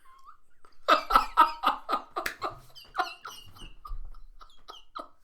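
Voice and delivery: high, helpless cackling; fit of laughter. Real, not acted. Storyteller cracks up at the meal, helpless laughter. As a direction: lose it completely, laughing.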